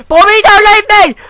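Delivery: high, fast shouted begging; panicked plea. Real, not acted. Player begging Bobby to stay, panicked fast shouting. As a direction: shout it as if being abandoned. Begging teammate not to abandon.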